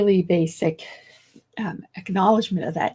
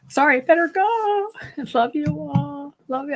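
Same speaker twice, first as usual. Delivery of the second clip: affectionate goodbye; 6 semitones higher, 4 dB louder than usual. Real, not acted. Host signing off to live viewers, affectionate high call.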